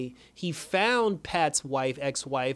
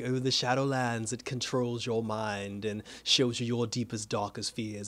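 lower, softer, menacing villain voice; the Shade ominous; 4 semitones lower, 4 dB quieter than usual. Retold or imitated. The Shade warning Pat about the Shadowlands, low and hushed. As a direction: sink the voice low and quiet like a threat. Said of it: "he was just like".

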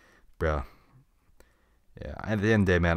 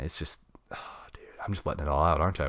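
whisper; 3 semitones lower, 6 dB quieter than usual. Real, not acted.

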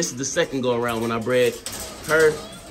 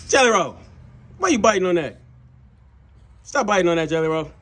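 scolding the dog; 4 semitones higher, 3 dB louder than usual. Real, not acted. Scolding dog chewing the fence.

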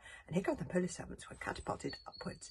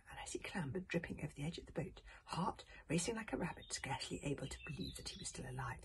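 hushed; 8 dB quieter than usual. A narrator's reading. Tense hushed narration.

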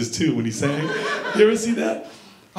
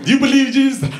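high mock preacher shout; preacher yelling; 8 semitones higher, 5 dB louder, faster than usual. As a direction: push it at him like a street preacher. Preacher yelling at him, voiced.

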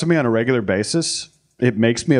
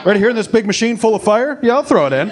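high, loud eager voice, throwing caution away; hammed-up overeager yes; 10 semitones higher, 4 dB louder than usual. Acting out horny eager self.

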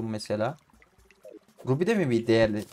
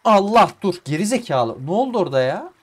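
loud, higher frustrated yelling at the screen; angry yelling; 7 semitones higher, 9 dB louder than usual. Real, not acted. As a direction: blow up at the game. Raging at game mishap. (oyuna sinirlenip yüksek sesle bağırıyor)

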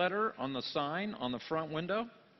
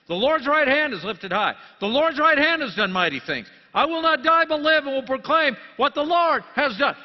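loud, higher, ringing preacher's cry; triumphant proclamation; 9 semitones higher, 12 dB louder than usual. Acted. Preacher crying out the verses to the church, loud and triumphant. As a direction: lift it like a victory shout.

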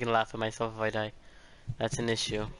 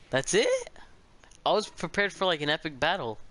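surprised; 5 semitones higher than usual. Real, not acted. Boss fight ends too soon.

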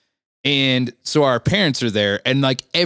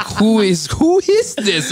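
high, slow, confused mock parents; 10 semitones higher, 4 dB louder, much slower than usual. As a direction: drag it out, baffled and high. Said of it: "my parents are like".

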